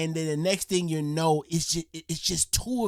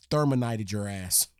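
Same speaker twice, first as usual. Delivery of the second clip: ordinary talk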